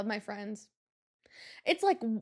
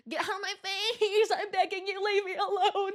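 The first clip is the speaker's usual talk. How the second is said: mock begging; 7 semitones higher than usual. Mock begging the old man.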